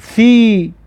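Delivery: ثی said with a light s-like sound and a clear ee; teacher models ثی as see with a bright ee; kasra sound thi. Shown as a demonstration. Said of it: "کسره که دارد".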